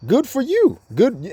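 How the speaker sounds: high mocking dismissal; sarcastic. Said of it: "Well, it's saying, like".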